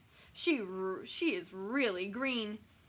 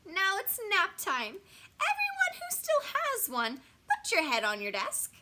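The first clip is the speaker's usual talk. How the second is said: high, loud teacher announcement; hammed-up classroom command; monster teacher; 11 semitones higher, 6 dB louder than usual. A narrator's reading. Monster teacher orders nap time.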